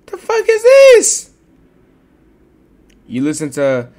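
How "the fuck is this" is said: high, loud stunned question; outraged disbelief. Real, not acted. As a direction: react like you can't believe what you see. Seeing unexpected song in playlist.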